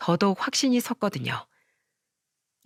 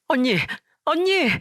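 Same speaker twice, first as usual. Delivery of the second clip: frantic cry; 7 semitones higher than usual. Acted. Younger sister screaming as her older sister collapses. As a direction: scream her name as she falls.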